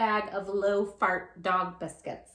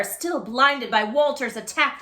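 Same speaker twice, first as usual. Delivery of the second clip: ordinary delivery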